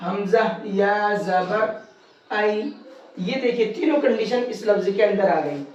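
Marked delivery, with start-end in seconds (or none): 0.1-2.6 slow, drawn-out letter-by-letter spelling; measured Qaida spelling of حمزہ یا زبر ائی; spelling-out demo. Shown as a demonstration. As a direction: spell it out slowly, then give the short blended sound ائی. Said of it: "آپ کو پڑھ کر کے یہاں پر بتاؤں گا".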